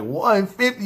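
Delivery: high-pitched shocked echo; incredulous. Retold or imitated. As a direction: repeat the price in mock disbelief. Retold shock at the higher price.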